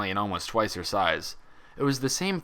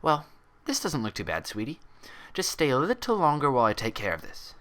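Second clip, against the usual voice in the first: gentle head nurse; 5 semitones higher than usual. A narrator's reading. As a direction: coax a child-like patient gently. Nurse treats patient's cut.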